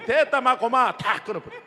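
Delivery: loud laughing cry of hang it all up; yell, half laughing, to cut the call; exasperated shout. Retold or imitated. Mother cuts off the prayer. (웃음 섞인 큰 고함)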